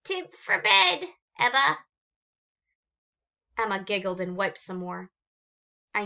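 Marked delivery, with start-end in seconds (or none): 0.0-1.8 stuffed-nose creature. Acted. Agatha urging Emma to bed, stuffed up and high. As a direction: squeeze it out through a clogged nose. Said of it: "through stuffed nose".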